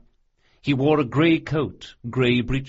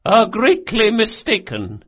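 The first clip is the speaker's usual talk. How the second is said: high, loud, cold rebuff; cold, level; 11 semitones higher, 7 dB louder than usual. A narrator's reading. Old noblewoman denying the intruder's claim, coldly. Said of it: "said Doña Vorcenza coldly and levelly".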